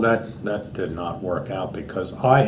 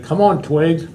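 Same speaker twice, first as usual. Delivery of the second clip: calling a dog; 7 semitones higher, 3 dB louder than usual. Retold or imitated.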